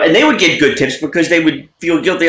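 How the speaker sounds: ordinary talk, nothing marked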